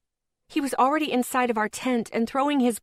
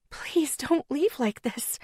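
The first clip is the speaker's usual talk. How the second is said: begging; 5 semitones higher, slower than usual. A narrator's reading. Woman begs boyfriend not to leave, slow. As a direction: beg him not to go. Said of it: "I begged".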